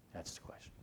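ordinary talk

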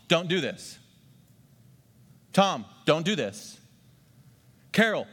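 firm, stressed don't to each name; repeated stern commands, lightly voiced; emphatic prohibition. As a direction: point at each person and forbid. God addresses individuals by name.

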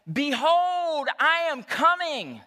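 mock preacher proclamation. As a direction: proclaim it like a nervous young pastor. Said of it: "preaching for the first time".

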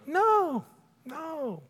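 very high-pitched squeaky no; mock a too-nice friend's denial; evasive denial. Acting out people dodging honest feedback.

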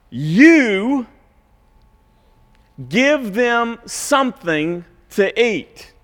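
slow, high, firm order to the disciples; emphatic command. Retold or imitated. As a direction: command it like a parent, spaced out. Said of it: "this is an emphatic command".

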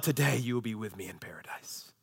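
normal talking voice

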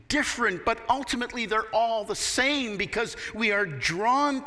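full-force sermon delivery; preaching. Acted.

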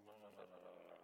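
low, soft grumbled blah blah; mock murmuring. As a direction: mutter it under your breath like a grumbling dad. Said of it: "I'm walking around the house going".

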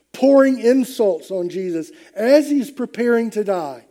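ordinary delivery

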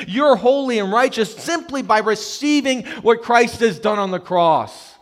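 loud, high, urgent proclamation; impassioned preaching. Acted. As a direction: preach it out at full force. Preaching God's welcome at full force.

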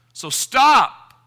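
forceful command. Real, not acted. Preacher commands the congregation to stop.

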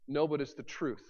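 ordinary talk, nothing marked

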